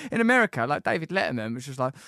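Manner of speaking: ordinary talk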